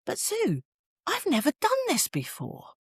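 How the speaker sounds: high-pitched quote of a dad; dad's admission. Retold or imitated. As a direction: voice a dad admitting it, pitched well up. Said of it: "And he said".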